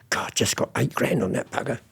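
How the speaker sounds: "God, just got eight grand on that bugger" as a gleeful secret whisper; hushed aside to himself; whispered thought. Retold or imitated. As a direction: whisper it, all breath, no voice. Retold thought at the auction.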